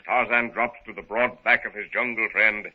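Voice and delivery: ordinary talk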